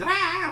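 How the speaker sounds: high, loud mock shriek; mock scream. Teacher imitating a screamy little girl, mock scream. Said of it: "always screaming".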